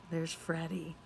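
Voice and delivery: ordinary delivery, nothing marked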